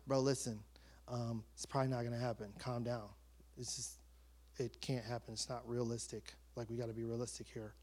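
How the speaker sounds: low, soft, subdued reply; hushed, low-key letdown; calming refusal. Retold or imitated. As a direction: talk him down quietly, voice dropped low. Acting out his reply to coach.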